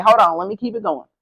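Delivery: ordinary delivery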